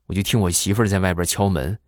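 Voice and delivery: usual speaking voice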